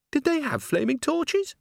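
a squeaky curious voice; high bystander. Acted. Bystander asks.

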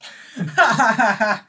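loud, high belly laugh; burst of laughter. Real, not acted. Friend cracking up at the end of the story, loud laughter. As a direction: crack up hard at a punchline.

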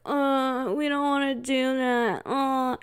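reluctant, whiny mock groan; mock groaning relatives. Uncles and aunties refusing the party game, mock groan. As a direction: moan it like bored relatives. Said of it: "start groaning".

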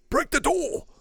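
very high-pitched character voice; put-on urgent command. Gamer voicing the order mid-game, high mock urgency.

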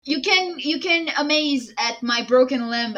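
high-pitched acted impatience; exasperated urging. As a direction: put off the fussing impatiently. Injured Trent urges Betty on.

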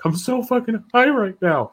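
high, loud wailed imitation; mock crying. Imitating the crying guy from the TikTok video, wailing. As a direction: wail it like a hammed-up sob. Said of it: "And he's crying".

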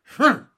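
put-on high loud baby humph; a baby's grunt. A narrator's reading.

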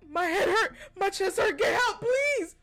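pleading for help. Retold or imitated. Telling a roommate about the pain, acted desperate plea.

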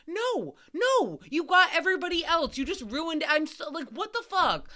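high, flustered scolding of mom; mock scolding. Telling off her mom after the scare, high and flustered. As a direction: scold her like she ruined dinner. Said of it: "And I'm like".

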